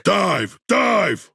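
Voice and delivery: high, shouted order to dive; shouted command. Acted. Squad leader ordering the team to dive, shouted. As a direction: bark the order, urgent and high.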